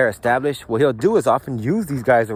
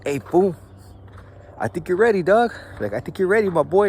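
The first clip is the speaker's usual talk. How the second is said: higher, coaxing hype-up voice; egging on; 6 semitones higher than usual. Retold or imitated. Leader coaxing a youngster to throw the fuck, egging. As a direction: pump him up like a big homie. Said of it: "is basically tell somebody, like".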